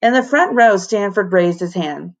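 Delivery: usual speaking voice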